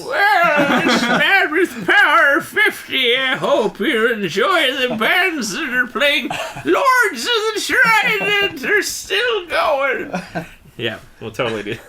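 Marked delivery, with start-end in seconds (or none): 0.0-10.1 high, slow, wailing fake sob; hammed-up tearful farewell; mock crying old man. Mock future festival farewell, tearful.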